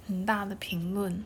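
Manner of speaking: barely voiced, trailing off; whispering. Real, not acted. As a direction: drop to a whisper. Hesitant, avoiding comment on song.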